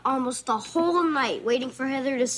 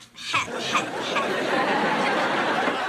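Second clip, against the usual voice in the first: breathy unvoiced snicker; gloating laugh; 3 semitones lower than usual. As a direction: laugh at him under your breath. Teasing sister gloats at tired brother.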